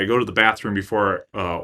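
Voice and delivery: usual speaking voice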